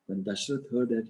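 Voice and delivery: plain reading voice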